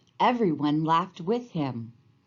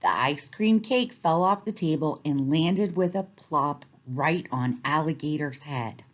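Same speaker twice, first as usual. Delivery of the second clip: ordinary delivery, nothing marked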